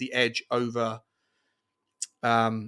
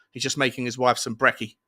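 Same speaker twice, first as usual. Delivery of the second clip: normal talking voice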